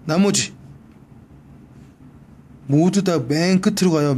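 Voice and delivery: ordinary delivery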